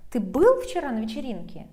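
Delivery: loud, quick question peaking on "был"; stress on БЫЛ. Shown as a demonstration. Teacher asking if you were there too, brisk stress on "был". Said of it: "emphasize this word with the intonation".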